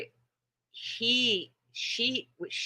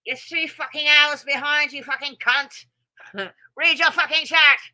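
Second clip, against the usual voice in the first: mock angry; 11 semitones higher, 6 dB louder than usual. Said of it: "I'm just kidding".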